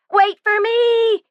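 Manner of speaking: high, slow, drawn-out shriek. A narrator's reading. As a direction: shriek after the departing family. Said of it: "shrieked Sarah".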